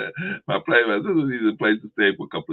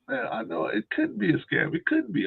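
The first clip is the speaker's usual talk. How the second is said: high mock disbelief; imitating sister in denial; 12 semitones higher, 4 dB quieter than usual. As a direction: pitch up, refusing to believe.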